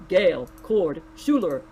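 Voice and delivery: robotic mirror voice; flat robotic assistant voice. A narrator's reading. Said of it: "replied in its robotic voice".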